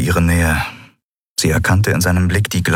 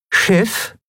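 transmitted command; 13 semitones higher than usual. Acted. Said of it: "sendete sie".

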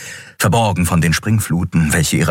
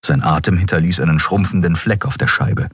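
usual speaking voice

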